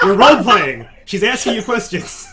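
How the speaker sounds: said through real laughter; laughing while explaining. Real, not acted. GM laughing at player confusion.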